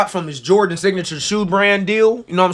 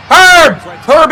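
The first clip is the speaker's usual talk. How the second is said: hype call; 7 semitones higher, 5 dB louder than usual. Real, not acted. Calling out the player.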